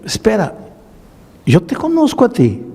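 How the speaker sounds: high, startled recognition; sudden recognition. Retold or imitated. Pastor recognizing the former drunk, startled and high. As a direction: catch yourself, you know this face.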